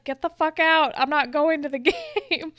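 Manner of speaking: hammed-up indignant yell; mock outburst. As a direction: act out an angry refusal, breaking into laughter. Retold outburst refusing friends' plan.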